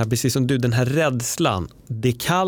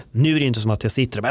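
usual speaking voice

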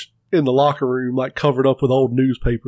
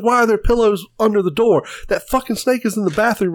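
lightly imitated wife, higher-pitched complaint; mock upset woman; 6 semitones higher than usual. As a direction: retell her outburst in a raised pitch. Kimberly's upset questioning, retold.

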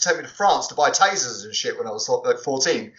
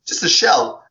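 ordinary delivery

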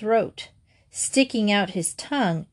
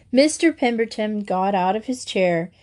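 plain reading voice

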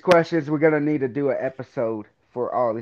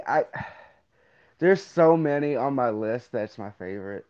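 normal talking voice

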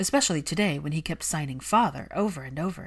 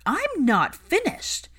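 higher, louder yelling; 12 semitones higher, 5 dB louder, much slower than usual. Acted. Hermione yelling at Malfoy about his son. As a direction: yell it for the child's sake.

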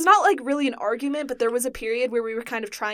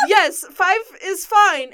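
eager reply; 7 semitones higher, 8 dB louder, slower than usual. Retold or imitated. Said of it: "And then I was like".

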